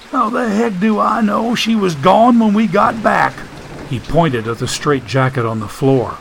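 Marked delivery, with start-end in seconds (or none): 0.1-3.3 high, exasperated character voice; high-pitched defensive retort; Joshua character voice. Acted. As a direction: play Joshua high and put-upon.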